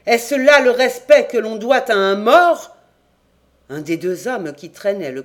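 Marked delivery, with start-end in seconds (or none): 0.1-2.8 a thundering roar, much louder and higher. A narrator's reading. Captain outraged at the crowd abusing a corpse, thundering. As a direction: boom it out in outrage. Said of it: "d'une voix de tonnerre".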